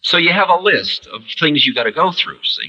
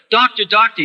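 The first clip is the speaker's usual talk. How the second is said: mock panic; 10 semitones higher than usual. Mock panicked dying person calling.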